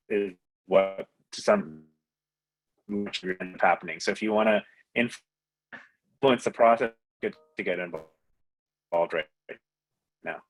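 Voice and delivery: choppy audio, words dropping out; bad connection cutting words; breaking up. Real, not acted. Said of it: "you're kinda breaking up".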